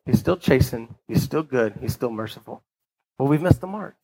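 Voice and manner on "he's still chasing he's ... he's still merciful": tender fade. Real, not acted. Sermon softens on God's goodness.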